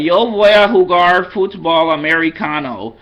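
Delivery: Americanized Spanish. Shown as a demonstration. Tutor mocking Americanized Spanish for learners, exaggerated American accent. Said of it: "sound crazy speaking a language or Americanized".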